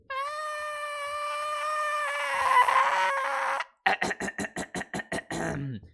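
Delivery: very high, loud long vocal wail that cracks; straining, cracking high note that hurts the throat; strained high wail. Real, not acted. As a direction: push out a screeching sustained note until it breaks. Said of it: "Oh, my throat".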